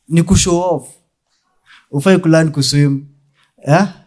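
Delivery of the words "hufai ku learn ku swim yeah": ordinary talk, nothing marked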